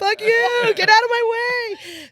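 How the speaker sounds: high, loud defiant yell; retold yell. Yelling at the driver blocking her lane, furious. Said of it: "And I'm like".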